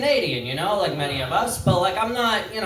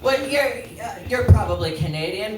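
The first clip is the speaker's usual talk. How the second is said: ordinary talk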